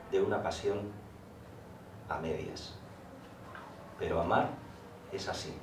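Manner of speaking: measured poem reading with long pauses between lines; poem recitation. Acted. Poet reciting the napkin poem to the audience, measured recitation. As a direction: recite line by line, phrase ends falling softly.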